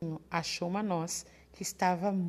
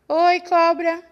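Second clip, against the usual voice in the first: ordinary delivery, nothing marked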